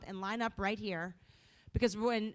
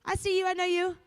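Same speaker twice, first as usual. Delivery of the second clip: friendly car horn; 7 semitones higher, 6 dB louder than usual. Pretend. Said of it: "One that says".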